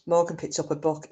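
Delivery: ordinary talk, nothing marked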